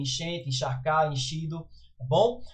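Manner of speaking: ordinary delivery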